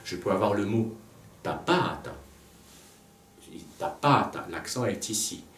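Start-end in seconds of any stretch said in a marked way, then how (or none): 1.4-2.1 taPAta, lengthened middle syllable; stress on middle syllable. Shown as a demonstration. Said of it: "L'accent tombe sur la pénultième". 3.8-4.5 tapata with a lengthened, raised middle syllable; taPAta, second syllable long; stress on middle syllable. Shown as a demonstration. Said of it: "L'accent tombe sur la pénultième".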